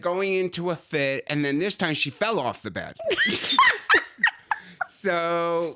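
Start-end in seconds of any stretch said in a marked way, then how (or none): 3.0-4.9 shrieking burst of laughter; real howling laugh; loud high laugh. Real, not acted. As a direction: let the laugh explode high. Laughing at mom's fall.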